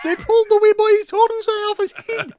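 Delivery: put-on the cow wailing that they pulled the wee boy's horns right off; Scottish cow voice, high and indignant; outraged Scottish cow.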